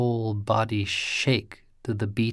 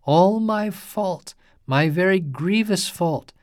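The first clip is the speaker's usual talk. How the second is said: grieving lament; 7 semitones higher than usual. A narrator's reading. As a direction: lament it as your own fault. Priest grieving over wounded friend.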